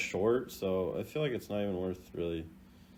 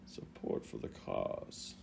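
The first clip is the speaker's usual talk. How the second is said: muttered echo; 10 semitones lower, 13 dB quieter than usual. Retold or imitated. Echoing the lines under breath.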